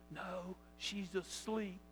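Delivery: slow, hushed whisper; softly, as if not to wake someone; whispering niece. Acted. Niece telling uncle her mother is asleep, whispering. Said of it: "she whispered".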